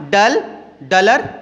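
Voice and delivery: ordinary talk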